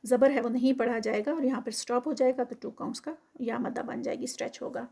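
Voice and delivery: ordinary talk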